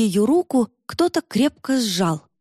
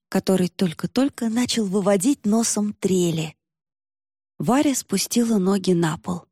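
ordinary delivery

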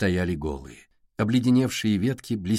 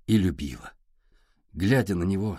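ordinary delivery, nothing marked

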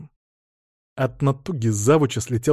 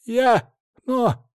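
high, broken stammer; stammer, much higher; 11 semitones higher, much slower than usual. A narrator's reading. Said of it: "заикался он".

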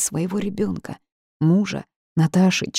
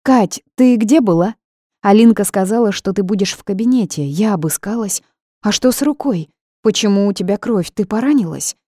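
higher, louder, agitated chatter; chattering questions; 5 semitones higher, 6 dB louder than usual. A narrator's reading. Natashka finding her friend with a bleeding hand, agitated chatter. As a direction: pour out the questions in a worried rush. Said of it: "протараторила Наташка".

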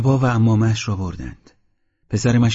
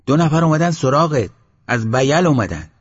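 high, loud shout of news; louder call; 6 semitones higher, 6 dB louder than usual. A narrator's reading. Villager insisting to the sheikh that visitors came, loud high shout. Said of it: "با صدای بلندتر گفت".

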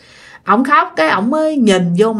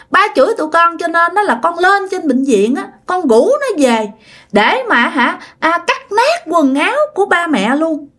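put-on higher, eerie childlike telling; child voice; 5 semitones higher than usual. Ghost child explaining to its mother why clothes were cut, child voice. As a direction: confess mischief like a small ghost child. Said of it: "cái giọng con nít".

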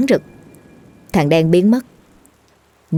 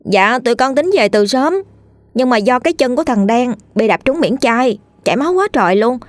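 put-on raised, boyish child voice; a young boy earnestly explaining himself; 6 semitones higher than usual. Boy explains his cut-foot delay to an upset father, in a higher child voice. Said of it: "thằng Lâm đứng ra giải thích".

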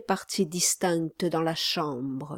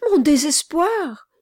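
higher, louder bitter retort; bitter mother; 9 semitones higher, 7 dB louder than usual. A narrator's reading. Mother retorts to the gentleman, higher and bitter. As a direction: throw his word back at him.

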